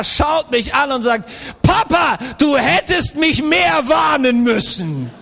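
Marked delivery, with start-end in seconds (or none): 1.6-5.1 put-on whining child. Injured son in the car blaming his father, whiny child voice. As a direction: complain to Papa like a hurt boy.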